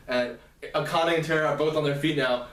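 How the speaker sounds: usual speaking voice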